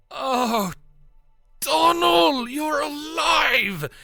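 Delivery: put-on high, loud delighted old man's cry; an elderly father thrilled to see his son alive; overjoyed old man. Old man greets lost son.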